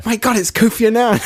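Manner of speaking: high startled mock gasp of recognition; mock surprise. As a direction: act shocked at spotting someone famous.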